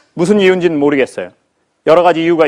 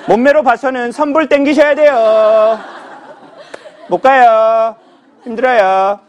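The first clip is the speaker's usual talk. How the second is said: smug lecturer; 4 semitones higher, slower than usual. Retold or imitated. 강사료를 묻는 사람에게 거들먹거리는 강사, 능청스러운 허세. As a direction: lord it over the client with mock arrogance. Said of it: "이제 사람이 이렇게 되기".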